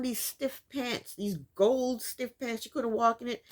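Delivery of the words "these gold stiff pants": usual speaking voice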